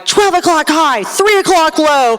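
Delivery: loud, high callouts of plane positions; yelling warnings. Retold or imitated. Observer in the back seat warning the pilot, yelling. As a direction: call out the collision warnings over the engine. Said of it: "the observers in the back seat are yelling".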